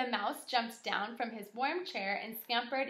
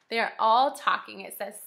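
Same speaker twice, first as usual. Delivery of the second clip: ordinary delivery, nothing marked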